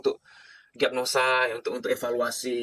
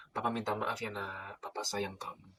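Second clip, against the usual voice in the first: much softer, low and gentle bedtime apology; tender apology; 5 semitones lower, 12 dB quieter, slower than usual. Shown as a demonstration. Father apologising to his sleepy child at night, soft and tender. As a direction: murmur it softly to a sleepy child. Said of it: "Saya kasih Anda tips ya".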